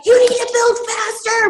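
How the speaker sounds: high, loud nagging shout; exaggerated impatient boss; mock pushy demand. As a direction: push them hard in a hammed-up yell. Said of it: "we're not like".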